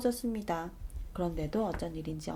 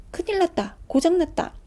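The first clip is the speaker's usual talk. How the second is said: high, loud panicked cry; alarmed children; 9 semitones higher, 6 dB louder than usual. Acted. Children finding their plane stuck, high alarmed cry. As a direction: squeal it out in alarm.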